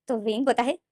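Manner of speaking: ordinary delivery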